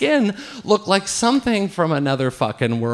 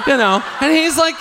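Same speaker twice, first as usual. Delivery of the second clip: ordinary talk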